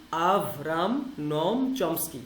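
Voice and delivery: আভরাম নম চমস্কি spelled out slowly, নম as nɔm; full name, slowly. Shown as a demonstration. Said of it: "আমি বলি যে নম". (ধীরে পুরো নাম উচ্চারণ)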